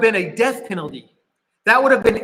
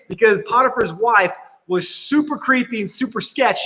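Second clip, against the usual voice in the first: ordinary talk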